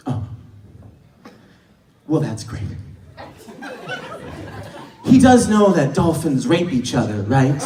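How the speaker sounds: a low, deadpan put-down; flat, unimpressed tone. Retold or imitated. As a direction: undercut him drily. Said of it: "Her response to this story, word for word, was".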